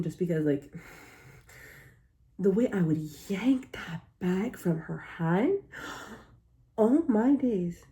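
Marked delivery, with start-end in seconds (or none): 6.2-7.8 shocked gasp. Real, not acted. Reacting to stolen food.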